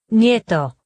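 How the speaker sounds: ordinary delivery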